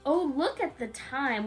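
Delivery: usual speaking voice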